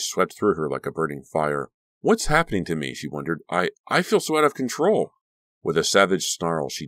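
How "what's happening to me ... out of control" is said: rising, high-pitched distress; alarmed wondering. A narrator's reading. Danielle alarmed at her own rage, distressed. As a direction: climb into helpless panic. Frightened thoughts amid sudden rage.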